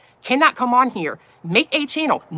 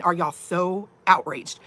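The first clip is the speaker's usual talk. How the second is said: slow and pointed; 3 semitones lower, 4 dB quieter, much slower than usual. Real, not acted.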